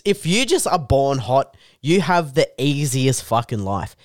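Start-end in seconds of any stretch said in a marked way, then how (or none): none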